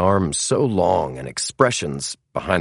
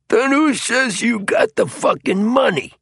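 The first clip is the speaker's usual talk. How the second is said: very high-pitched thug's demand; aggressive challenge; 4 dB louder than usual. A narrator's reading. Other troglodyte doubting the buyer has money, aggressive challenge.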